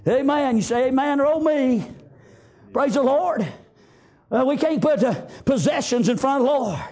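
ordinary talk

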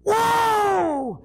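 high, long whoop falling away; imitated full-throated whoa; reared-back holler. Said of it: "he reared back".

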